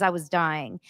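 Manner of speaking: ordinary delivery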